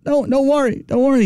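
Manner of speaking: high, soothing Queens deer voice; lightly played deer reassuring the crowd. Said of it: "the reassuring voice of Mister Deer".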